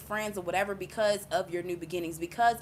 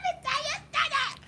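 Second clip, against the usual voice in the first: much higher, shouted out. Real, not acted. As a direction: call it out to grab attention. Laughing build-up to a point.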